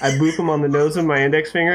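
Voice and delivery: ordinary talk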